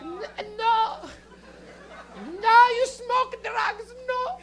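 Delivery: high, slow appalled immigrant parent; hammed-up scandalized parental refusal. Comic as immigrant parent hearing of drugs, high mock outrage. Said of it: "immigrant parents where they're like".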